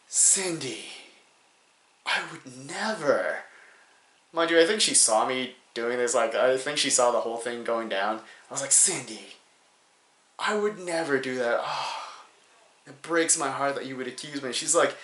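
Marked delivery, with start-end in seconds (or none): 0.1-3.4 soft, slow, wounded denial; mock-offended innocence. Driver denying burnout to friend's mom, mock-offended. 8.7-14.5 softly wounded, theatrical denial; mock-hurt innocence. Driver accused by friend's mom, mock-hurt.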